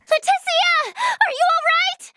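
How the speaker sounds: very high, urgent call; alarmed cry. Acted. Calling to a friend in danger, alarmed. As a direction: cry out in sudden worry for a friend.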